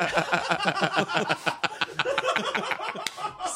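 long high cackling laugh; laughter. Real, not acted. Janitor cracks up at his own bit, laughing. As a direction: crack up in a high, sustained giggle.

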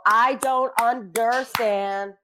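very slow, drawn-out words; deliberate, slowed-right-down delivery; slow demonstration. Shown as a demonstration. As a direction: stretch out every syllable. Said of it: "I go like this".

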